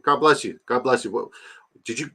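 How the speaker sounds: usual speaking voice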